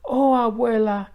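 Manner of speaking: put-on girl crying out with joy; glad cry. A narrator's reading. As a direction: burst out in relief. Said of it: "She cried".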